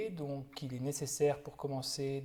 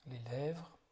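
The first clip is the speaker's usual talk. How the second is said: calm, unhurried body-scan guidance with long silences; guided meditation; 4 dB quieter than usual. Real, not acted. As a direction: guide them gently through the body, leaving space after each phrase. (méditation guidée, voix calme et posée)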